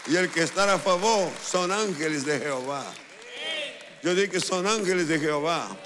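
voz potente de predicador; prédica a plena fuerza. Real, not acted. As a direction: proclamarlo fuerte a la congregación. (proclamación enérgica)

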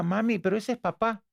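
put-on high, innocent child voice; little son telling his mother it's his dad; child's voice. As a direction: play the little kid correcting mom. Child corrects mother in story.

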